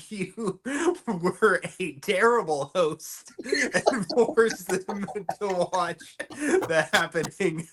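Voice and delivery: slow, laughing through the words; can barely get it out for laughing. Real, not acted. To co-host, laughing about forcing guests to watch it.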